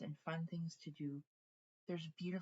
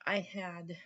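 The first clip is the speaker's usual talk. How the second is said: normal talking voice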